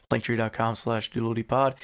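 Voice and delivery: ordinary talk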